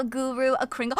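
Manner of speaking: normal talking voice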